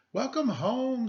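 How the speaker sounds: high, cheerful greeting lilted out; cheery welcome. A narrator's reading. Father welcoming his daughter home, high and cheerful. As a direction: welcome your girl home with delight. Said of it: "said her father".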